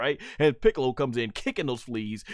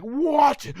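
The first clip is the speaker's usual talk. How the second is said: loud, high incredulous "What?"; hammed-up shocked outburst; mock disbelief; 12 semitones higher, 7 dB louder than usual. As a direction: yelp it as if you can't believe it. Acts out Vegeta's disbelief.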